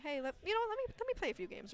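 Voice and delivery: high, quick "let me play"; eager high request. Retold or imitated. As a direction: beg eagerly to join. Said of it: "just to make sure, like".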